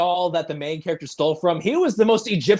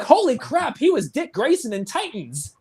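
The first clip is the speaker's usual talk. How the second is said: high exclaim of recognition; a surprised high realization; mock surprise; 7 semitones higher than usual. Remembering spotting the actor on IMDb, high and surprised. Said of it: "I'm like".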